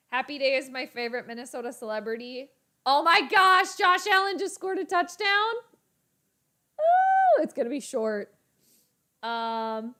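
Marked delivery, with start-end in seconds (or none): 2.8-5.5 loud and high burst of joy; excited shout. Real, not acted. Streamer shouting as Josh Allen scores. 6.8-7.4 loud, very high gasp of suspense; high ooh. Real, not acted. Streamer gasping at the kick attempt.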